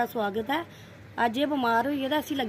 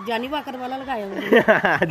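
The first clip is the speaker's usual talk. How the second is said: words through laughter; amused laughing tease; 6 dB louder than usual. Real, not acted. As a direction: laugh while telling the joke. Joking about his glasses.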